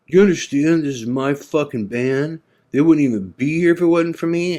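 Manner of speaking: high, mocking drunken rant. Drunk owner claiming the band as his, mock angry rant. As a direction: ham up the drunk owner's outrage. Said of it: "and he's going".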